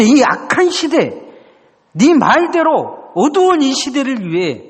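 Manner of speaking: high, slow and solemn; God's calling voice. Retold or imitated. God asking the young man who will go, high and solemn. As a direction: lift it slowly like a summons from above.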